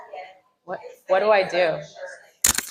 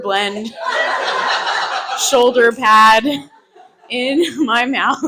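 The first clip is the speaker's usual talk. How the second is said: giggling through the words; laughing; 3 semitones higher, 4 dB louder than usual. Real, not acted. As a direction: laugh while telling it.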